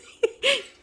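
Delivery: a loud, high peal of real laughter. Real, not acted. Owner cracking up watching her birds, high loud laugh. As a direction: burst out laughing again.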